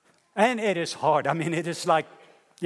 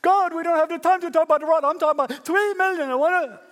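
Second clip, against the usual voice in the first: high, loud and fast; impatient Moses; 12 semitones higher, 5 dB louder than usual. Acted. Moses brushing God off, high, loud, hurried. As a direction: rush past the question. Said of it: "God said".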